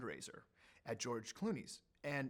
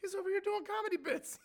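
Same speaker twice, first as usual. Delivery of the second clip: amazed Jordan; 3 dB louder than usual. Retold or imitated. Jordan surprised by the president.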